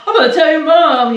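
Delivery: loud, high-pitched taunt; imitating an outspoken kid's threat; mock tattling. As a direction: call it out like a tattletale. Acted threat of a tattling friend.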